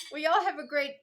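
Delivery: talking with a mouthful of cake; mouth full. Real, not acted. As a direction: say it through a mouthful. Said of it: "I'm eating with my mouth full".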